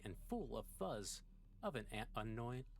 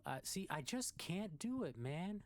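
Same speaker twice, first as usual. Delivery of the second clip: much higher, stretched rising "like" in a goofy cartoon voice; Shaggy impression; 13 semitones higher, slower than usual. Gamer tries a self-admittedly bad cartoon voice, squeaky. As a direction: attempt a squeaky slacker cartoon voice. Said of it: "I can't do a good Shaggy impression".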